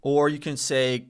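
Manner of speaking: ordinary delivery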